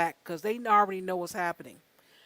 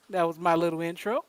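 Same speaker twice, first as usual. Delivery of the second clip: ordinary talk, nothing marked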